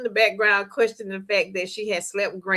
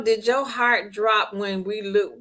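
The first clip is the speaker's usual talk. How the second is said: normal talking voice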